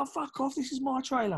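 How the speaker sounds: mock drunken protest; hammered dismissal. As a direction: wave them off like an indignant drunk. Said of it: "he's hammered".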